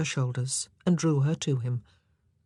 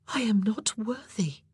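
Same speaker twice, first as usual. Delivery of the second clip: high, whispery, humble protest; overwhelmed young woman; 6 semitones higher than usual. A narrator's reading. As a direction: shrink back in a high hush. Anne, overwhelmed by the proposal.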